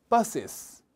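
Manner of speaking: "buses" with both s sounds clearly said; clear plural ending. Shown as a demonstration. Teacher drilling the plural, both s sounds clear. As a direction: say "buses" as two syllables, sounding the s twice. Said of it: "S 발음을 꼭 두 번 해주셔야 됩니다".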